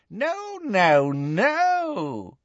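high, swooping gleeful villainess; Bellatrix. A narrator's reading. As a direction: refuse with relish, sliding high. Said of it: "She looked transported".

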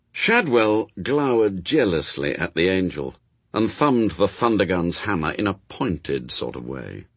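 usual speaking voice

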